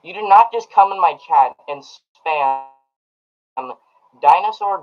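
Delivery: loud, emphatic pretend telling-off; mock scolding. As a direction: scold a chat spammer in mock outrage. Mock scolding a chat spammer.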